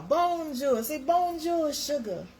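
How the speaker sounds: high sing-song bonjour, sugar; playful French greeting. Shown as a demonstration. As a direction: coax sweetly in mock French. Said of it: "that's my French".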